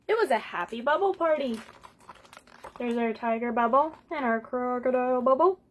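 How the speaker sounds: plain reading voice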